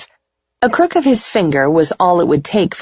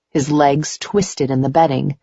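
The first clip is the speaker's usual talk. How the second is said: plain reading voice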